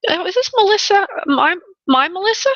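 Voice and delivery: light up at a familiar name; surprised joy at a friend; delighted surprise. Real, not acted. Recognizes her regular client.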